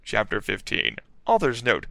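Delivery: ordinary delivery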